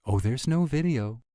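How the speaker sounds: high, loud protest; insistent denial. Acted. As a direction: deny it loudly, pitched right up. Caught out, denies the video.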